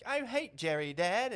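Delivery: high, loud mock child voice; hammed-up little English child; English little kid. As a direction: play a worked-up English kid talking to Dad. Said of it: "in England especially".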